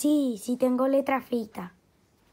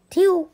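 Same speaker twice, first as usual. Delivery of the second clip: short high loud alien "tiu"; alien sound; 7 semitones higher, 10 dB louder than usual. Pretend. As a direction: zap it out like a space noise. Alien kid makes planet sound.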